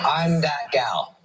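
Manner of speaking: slow, drawn-out pet voice; voiced pet. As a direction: stretch it out as the sassy dog would. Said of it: "She goes".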